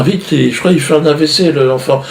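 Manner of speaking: hammed-up cry for the doctor; mock panic. Wish fairy calling the doctor, mock panic.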